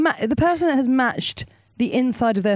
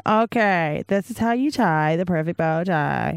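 put-on American accent; slower than usual. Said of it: "American teenagers going".

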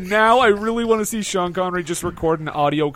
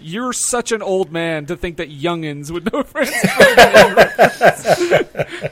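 laughing through the words; cracking up mid-sentence; 6 dB louder than usual. Real, not acted. Laughing while quoting Jason.

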